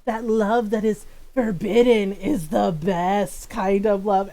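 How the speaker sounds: slow, hammed-up forbidden-love line; mock romantic. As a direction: drag it out like a cheesy romance hero. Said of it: "He trolls so hard".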